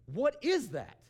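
high, loud, startled question; astonished reaction. Retold or imitated. Woman reacting to sudden healing prayer, loud astonishment. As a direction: blurt it out in amazement.

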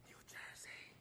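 sly whispered prompt. Retold or imitated. Class clown feeding a classmate the wrong answer, whispered. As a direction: whisper it very softly, without voice.

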